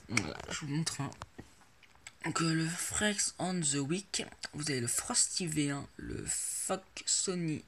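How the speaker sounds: ordinary talk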